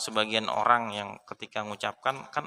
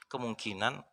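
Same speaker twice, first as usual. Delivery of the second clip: usual speaking voice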